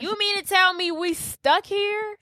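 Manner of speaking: high, outraged question; mock-indignant high voice; incredulous. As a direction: pitch it way up in disbelief. Marnie voiced, disbelieving she is stuck.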